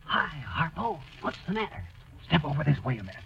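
hushed, furtive undertone; secretive whisper. Acted. Mister Wilcox drawing friends away from sleeping campers, secretive hush. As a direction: keep it down so nobody else hears. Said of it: "What are you whispering that".

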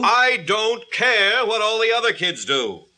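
high-pitched, exasperated retort; heated parental refusal; angry outburst. Acted. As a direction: lose patience and raise the voice. Father's escalating retort in quarrel.

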